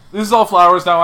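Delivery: loud retold complaint; fed up. Tired visitor quitting the botanical garden, fed up.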